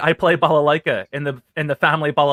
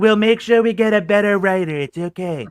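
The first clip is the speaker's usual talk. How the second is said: Trump impression; 4 semitones higher, 6 dB louder than usual. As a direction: bluster at Melania about the roses. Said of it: "and I do the Trump".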